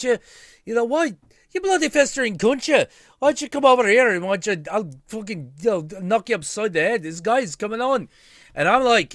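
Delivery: put-on high, loud, fast drunk Irish rant; imitating roommate Rory. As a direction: rattle off Rory's rant in a high brogue. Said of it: "imitating my old roommate, Rory".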